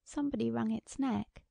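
ordinary delivery, nothing marked

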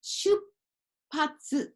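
出発 as shup, silent pause, patsu; pause for small tsu. Shown as a demonstration. Teacher showing learners the unsounded small tsu, 出発 split by a clear pause. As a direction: hold a silent beat inside 出発 before the pa. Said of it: "ここはポーズになりますね".

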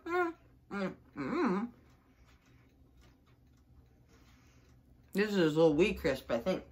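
chewing while talking; speaking around food; mouth partly full. Real, not acted. Seen 0: Bites bar earlier, chews, hand to mouth while speaking.

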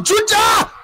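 high shouted name; excited call. Real, not acted. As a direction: call his name as he shoots. Commentator calling a chance.

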